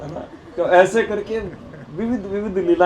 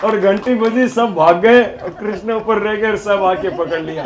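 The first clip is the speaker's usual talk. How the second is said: usual speaking voice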